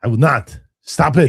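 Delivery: loud, high, defiant mock Arnold; mock Arnold defiance. Host playing Arnold, brushing off a heart attack warning, loud and defiant. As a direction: shout it like a stubborn old star refusing. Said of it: "John Roca did not like this".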